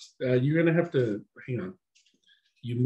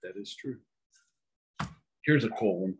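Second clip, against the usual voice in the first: ordinary talk, nothing marked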